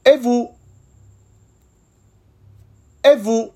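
"Et vous?" high and bright, "et" stressed; repeat-after-me French. Shown as a demonstration. Teacher drilling learners, clear repeatable French. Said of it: "Ahora escucha y repite".

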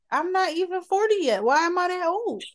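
high exasperated whine. Real, not acted. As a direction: complain in disbelief. Exasperated about aging.

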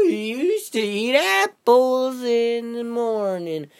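slow, drawn-out put-on voice, starting very high; put-on old-timer voice. Vlogger reminiscing about back in my day, slow hammed-up old-timer voice. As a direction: stretch every word like a hammy old-timer. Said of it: "What is this voice?".

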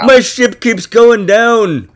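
loud, drawn-out put-on Irish accent. Host playing Columbus on his ship, mock Irish accent. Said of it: "he's got an Irish accent".

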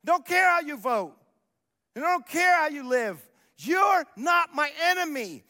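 loud, high, slowing at the end; declared shout. Retold or imitated. Pastor voicing Paul to the congregation, in a ringing shout. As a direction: proclaim it to the whole room. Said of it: "Paul helped us understand".

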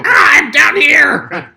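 high, loud, slow shout; distant shout. Pretend. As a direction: call up from below. Acted shout from below.

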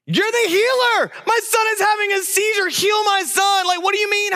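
very high, desperate shouting; frantic dad. Retold or imitated. Dad begging the healer to save his son, frantic. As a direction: shout like a distraught father demanding help. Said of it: "feel the emotion in this text".

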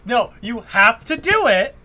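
loud, slow, drawn-out insistence; urging a friend to say it, loud and slow; insistent shout. Real, not acted. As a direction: lean on every word, slow and loud.

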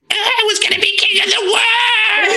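high shrieking cartoon villain cry; anime villain voice. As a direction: scream it like a defeated anime villain. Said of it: "shitty anime villain voice".

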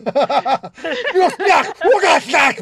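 laugh, then loud guttural demon-language gibberish; mock-aggressive Infernal. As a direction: bellow harsh made-up Infernal words for comic effect. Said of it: "really aggressive".

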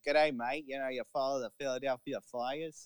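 mock Australian accent, lightly done; a jokey South Jersey Aussie greeting. Aussie accent mocking South Jersey locals. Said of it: "has the Australian accent".

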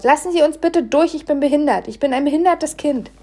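loud, high shouted plea; brüllend. A narrator's reading. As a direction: yell it at everyone in the way. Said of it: "brüllte ich sofort". (laut und hoch gebrüllt)

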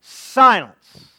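loud commanding shout; Jesus rebuking the wind with a loud command; rebuke. A narrator's reading. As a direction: silence the storm with one blast. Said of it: "he rebuked the wind".

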